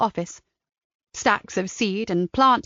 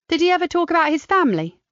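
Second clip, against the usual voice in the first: higher, quick and admiring; Kat's voice; 8 semitones higher, faster than usual. A narrator's reading. Kat compliments Mister Shipley's garden, higher and quicker. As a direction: gush over his garden.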